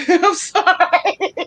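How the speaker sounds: slow, high apology through laughter; giggling I'm sorry; laughing. Real, not acted.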